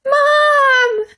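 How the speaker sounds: high, loud shrieked call; little brother shrieking for Mum. A narrator's reading. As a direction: scream it for Mum. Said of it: "shrieked Peter".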